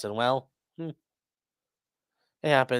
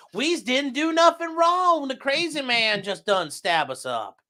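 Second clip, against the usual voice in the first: slow, high hillbilly Texas drawl; mock a simple Texas victim; hammed-up Texas accent, pleading innocence; 8 semitones higher, slower than usual. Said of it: "was that a Texan's, Texas accent at the start?".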